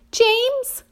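very high, pleading call; crying out. A narrator's reading. Earthworm calls on James for rescue, panicked cry. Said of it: "cried the earthworm".

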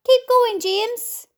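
high-pitched shout of encouragement; crying out. A narrator's reading. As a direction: urge him on loudly. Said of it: "they cried".

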